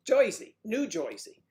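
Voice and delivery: hammed-up "Joisey" New Jersey accent; Jersey with an OY vowel, New Jersey style; punchy, high-pitched New Jersey accent. Mimics local Jersey accent.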